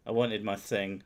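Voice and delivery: normal talking voice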